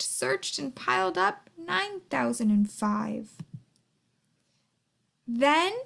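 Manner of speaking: ordinary delivery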